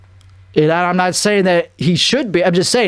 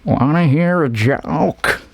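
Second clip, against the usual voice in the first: Heath Ledger voice; slower than usual. Retold or imitated. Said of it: "he'd be like".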